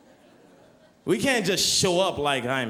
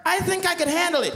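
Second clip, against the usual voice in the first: put-on high, keen child voice; an eager boy speaking to the official; eager little kid; 11 semitones higher than usual. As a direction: play a boy volunteering.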